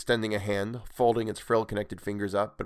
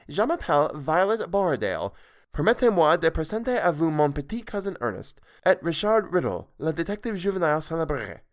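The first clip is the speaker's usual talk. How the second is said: put-on much higher girl's voice, formal French introductions; careful schoolroom French; 7 semitones higher than usual. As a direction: introduce everyone like a proud pupil. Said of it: "said slowly and clearly in the manner approved by her tutor".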